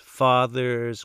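ordinary delivery, nothing marked

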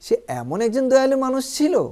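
surprised exclamation. Shown as a demonstration. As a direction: marvel at how kind he was. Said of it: "এটাও কিন্তু একটা surprise প্রকাশ করছে". (বিস্ময় প্রকাশ করে)

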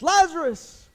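huge high commanding shout; booming yell, pitch way up. Acted. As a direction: call the dead out of the tomb. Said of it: "the Lord yelling out".

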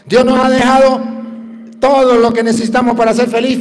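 loud, fervent preaching, pushed high; preaching at full force. Acted. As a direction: drive the lesson home to the congregation. Preaches the moral loudly. (predicando a todo pulmón)

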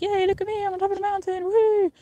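mock cheery brag. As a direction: play the fake happy influencer. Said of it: "tempting to be like".

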